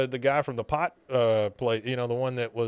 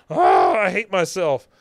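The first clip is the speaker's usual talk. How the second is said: louder, higher mock despair; anguished outburst; 6 semitones higher, 8 dB louder than usual. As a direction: wail it in self-disgust. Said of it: "she goes".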